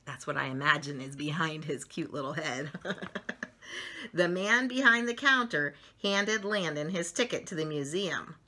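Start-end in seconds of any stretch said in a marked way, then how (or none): 4.1-8.4 lively picture-book narration; reading to children. A narrator's reading. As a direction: read it like bedtime story time for little ones.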